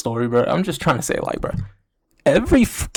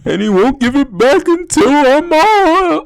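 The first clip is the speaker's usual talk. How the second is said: high, slow, mock weepy whine; imitating crying girl; 11 semitones higher, 4 dB louder, slower than usual. The crying employee complaining to her manager, mock sobbing. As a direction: drag it out like a teenager in tears. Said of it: "she's fucking crying".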